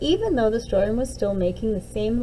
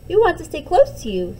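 put-on scared child; 6 semitones higher, 5 dB louder, faster than usual. Scared child pleads to mom.

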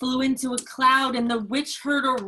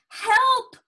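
high, loud storybook plea; acted yelp for rescue; cry for help; 11 semitones higher, 6 dB louder than usual. A narrator's reading. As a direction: call out as the frightened witch. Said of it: "cried the witch".

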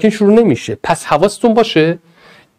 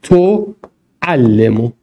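slow dictation; 5 semitones lower, much slower than usual. Shown as a demonstration. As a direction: dictate تعلمو at a crawl. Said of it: "امر اینا رو بسازید".

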